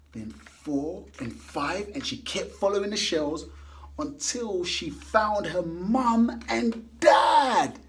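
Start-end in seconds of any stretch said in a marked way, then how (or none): 4.0-7.8 joyful climax. A narrator's reading. Happy reunion climax.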